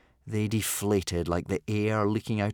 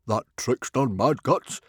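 higher-pitched tutting disapproval; mild disgust; 4 semitones higher, 4 dB louder than usual. A narrator's reading. Stoic on the trickster who cheated him, mildly disgusted. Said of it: "tut-tutting in mild disgust".

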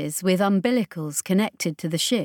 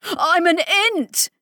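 high, strained squeak; 10 semitones higher, 4 dB louder, slower than usual. A narrator's reading. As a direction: squeak it out desperately. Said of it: "he squeaked".

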